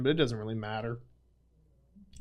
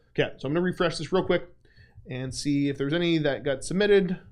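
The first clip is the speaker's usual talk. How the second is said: normal talking voice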